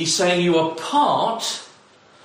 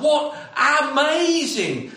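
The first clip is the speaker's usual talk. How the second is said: ordinary talk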